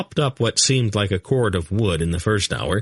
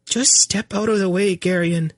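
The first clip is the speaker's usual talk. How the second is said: high queen voice, resigned and weary; 12 semitones higher than usual. Acted. Queen to her useless husband, resigned. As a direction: give up on him and sigh it out. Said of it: "she said with resignation".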